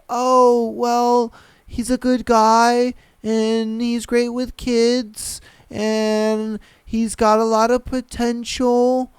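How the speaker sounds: slow, hesitant, drawn-out mimicry; mock reluctance. Imitating a woman asked about her boyfriend, reluctant. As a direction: drag it out as if reluctant to praise. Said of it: "very reluctant".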